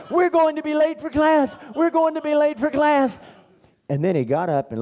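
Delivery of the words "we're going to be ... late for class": much higher, louder frantic wail; mock tantrum. Roommate on the floor mocking worry, hammed-up wail. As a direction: wail it like a kid beating the floor.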